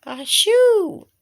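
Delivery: big loud cartoon sneeze. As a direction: sneeze it out loudly. Sneeze in the story.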